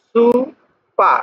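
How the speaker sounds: "সুপা" with both vowels stretched very slowly; drawn-out vowels. Shown as a demonstration. Teacher showing katakana long marks, "সুপা" drawn out. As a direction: draw out each syllable of "সুপা". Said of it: "এখানে একটা টান আছে".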